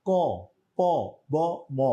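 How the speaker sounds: ক, প, ব, ম each voiced with its inherent অ vowel; sounding out letters. Shown as a demonstration. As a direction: model each consonant with its hidden অ. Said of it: "যেমন উচ্চারণ করেন তো স".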